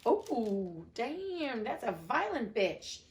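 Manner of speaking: ordinary delivery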